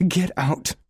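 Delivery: high, loud furious shriek. A narrator's reading. Sarah throwing Leo out, furious high shriek. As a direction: shriek him out the door. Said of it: "she shrieks".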